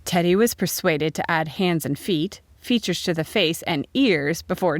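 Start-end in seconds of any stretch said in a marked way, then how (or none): none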